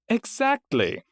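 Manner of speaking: high, chirpy clerk; cheerful confirmation; bright receptionist. A narrator's reading. Heidi replies.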